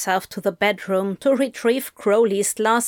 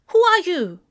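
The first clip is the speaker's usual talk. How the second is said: put-on aggressive child; 9 semitones higher, 4 dB louder than usual. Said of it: "in that aggressive way only children".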